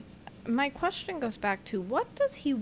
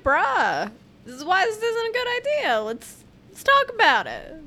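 casual bro plea; 10 semitones higher, 9 dB louder than usual. Pretend. Imagined casual plea to Putin.

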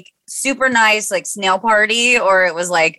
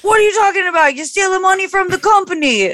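boss quote; 13 semitones higher than usual. Retold or imitated. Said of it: "he was like".

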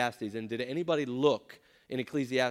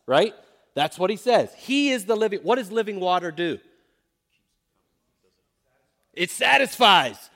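louder, driving preacher's cadence; preaching; 5 semitones higher, 6 dB louder than usual. Acted. Pastor driving the living water image home to students, preaching hard. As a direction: build it like a pulpit call, punching each claim.

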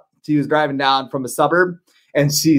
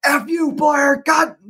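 mock yell from a bitter fan, much higher; imitating a fan's scream; 12 semitones higher, slower than usual. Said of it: "one guy just scream".

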